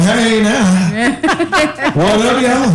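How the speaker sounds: lightly voiced street preacher; mock preacher calling out; street corner preacher. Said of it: "We on the street corner going".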